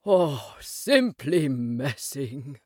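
slow, low, lost in reverie; dreamy. Acted. Rat rhapsodises while rowing, dreamily. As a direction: drift off on the words. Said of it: "he went on dreamily".

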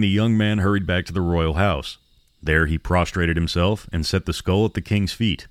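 ordinary delivery

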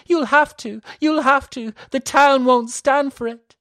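high, loud anguished insistence; despairing. A narrator's reading. Elisa insisting to her sister, despairing cry.

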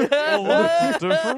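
high laughing echo. Real, not acted. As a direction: say it cracking up. Laughing repeat of the quote.

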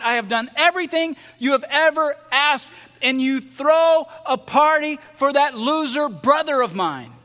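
loud, high, defiant shout; older son refusing to join the party, loud and bitter; angry refusal. Acted. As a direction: slam the door on his father's plea. Stiff arms him.

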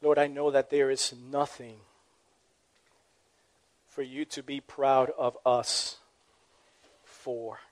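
quiet humble prayer to God. Acted. Leads the closing prayer.

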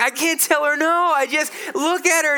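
high, loud mock helplessness; helpless dad. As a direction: whine it like a dad wrapped round her finger. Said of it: "I'm like".

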